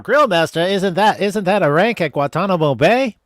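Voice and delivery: put-on lightly old-timey announcer voice; a hint of an old radio host; 1930s voice. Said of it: "I don't know why I had to do nineteen thirties voice".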